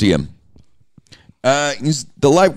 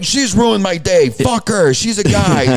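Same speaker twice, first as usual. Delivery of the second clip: imitating a ranting Howard Stern; 7 semitones higher, slower than usual. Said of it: "He literally went from that to".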